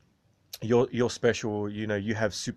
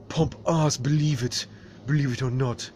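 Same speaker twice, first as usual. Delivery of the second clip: high, cocky catchphrase; Pompous catchphrase; 4 semitones higher than usual. Acted. Pompous boasting to the viewers, high and cocky. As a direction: tease it out, up high.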